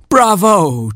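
high, chortling cheer; a delighted, chuckling bravo; chortled bravo. A narrator's reading. King frog cheers the butterflies, chortling. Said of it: "chortled the King Frog".

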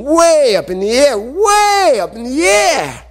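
WAY stretched out and climbing very high; soaring emphasis. A narrator's reading. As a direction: send “way up in the air” soaring upward, slowly. Acting out the huge throw.

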